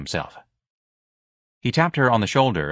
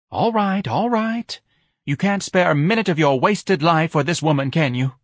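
high, exasperated wife voice; high-pitched scolding wife; 8 semitones higher, 4 dB louder than usual. A narrator's reading. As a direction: reproach your husband, pitched well up.

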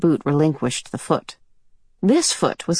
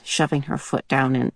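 ordinary delivery

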